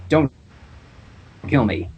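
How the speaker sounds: usual speaking voice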